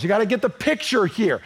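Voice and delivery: usual speaking voice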